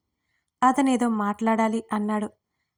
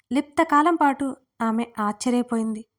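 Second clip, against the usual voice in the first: ordinary delivery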